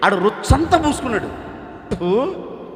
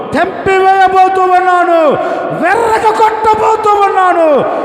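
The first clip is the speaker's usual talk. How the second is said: much higher and louder, triumphant; shouted promise; 12 semitones higher, 10 dB louder, slower than usual. Acted. Pastor voicing God's promise to the crowd, shouting. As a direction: roar the promise out.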